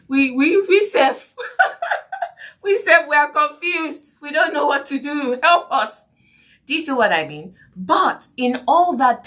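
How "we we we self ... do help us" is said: confused parents. Retold or imitated. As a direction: play the lost, helpless parent. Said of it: "the parents will show up and say".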